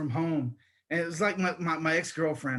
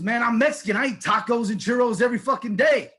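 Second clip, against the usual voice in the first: higher, louder exasperated rant; comic mad outburst; 6 semitones higher, 5 dB louder than usual. Pretend. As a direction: blow up in hammed-up indignation. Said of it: "why are you so mad?".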